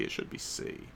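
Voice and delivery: unvoiced murmur to self; whispered correction; whispered aside. Real, not acted. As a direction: mutter it under your breath. Muttered aside correcting translation.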